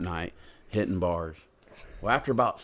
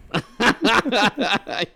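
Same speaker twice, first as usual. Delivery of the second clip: high loud laugh at his own joke; real laugh breaking out; big burst of laughter; 13 semitones higher, 4 dB louder than usual. Real, not acted. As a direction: crack up hard.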